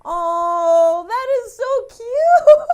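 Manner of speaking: melting at something sweet, real; touched and delighted. Real, not acted. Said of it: "It's almost making me wanna cry".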